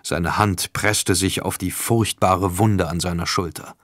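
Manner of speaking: ordinary delivery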